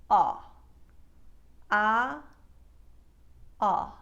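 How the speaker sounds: loud, narrow, forward ah. Shown as a demonstration. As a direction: round the mouth in and push the ah forward. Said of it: "the sound is more narrow and forward".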